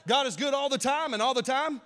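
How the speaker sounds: high, loud call to the church; preacher call-out. Real, not acted. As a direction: call it out to the congregation.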